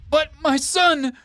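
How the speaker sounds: a slow, high, shouted wail of grief; anguished cry. Acted. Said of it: "Richard cried as tears streamed".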